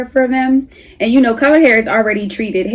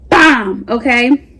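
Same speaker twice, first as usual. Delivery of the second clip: usual speaking voice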